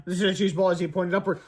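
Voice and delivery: ordinary delivery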